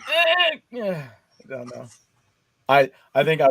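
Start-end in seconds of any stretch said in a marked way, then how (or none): 0.1-0.6 high jolted grunt; sudden high mock cry of a shock; mock shocked yelp. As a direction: yelp as if zapped. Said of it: "Be like".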